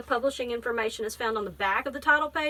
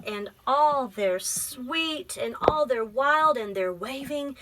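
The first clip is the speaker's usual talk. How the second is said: gentle, measured picture-book reading; teacher reading a poem book aloud to a child; reading to children; slower than usual. Acted. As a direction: read it like story time.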